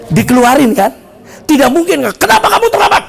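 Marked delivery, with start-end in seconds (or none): 2.2-3.0 raised, high-pitched teacher's scolding; angry scolding. Acted. Teacher confronting a late student, scolding sharply. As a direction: demand an explanation from a latecomer.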